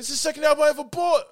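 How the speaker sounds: high, fast acted protest; mock indignation. Retelling a friend seeing his record thrown out, high indignant cry. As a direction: wail it in a put-on voice.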